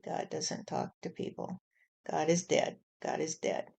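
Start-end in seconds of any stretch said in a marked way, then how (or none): 0.0-1.6 soft unvoiced mutter of the doubters' words; whispered. Mocking quote, whispered. 3.0-3.7 whispered echo. Pretend. Repeated line, whispered echo.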